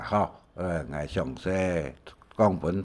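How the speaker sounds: usual speaking voice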